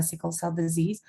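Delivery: usual speaking voice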